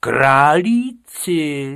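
loud, drawn-out outraged question; indignant teacher's exclamation. A narrator's reading. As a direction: stretch the word out high and loud. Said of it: "zvýšil hlas pan učitel".

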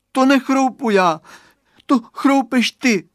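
high, loud counter-accusation; indignant little girl. A narrator's reading. Girl blaming the boy for crunching, indignant. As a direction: throw the blame back, loud and high. Children quarrel over who is crunching.

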